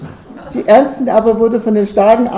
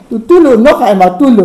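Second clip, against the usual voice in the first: loud, blustering grumble; grumbling outburst; 2 semitones higher, 9 dB louder than usual. A narrator's reading. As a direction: grumble loudly at her in annoyance.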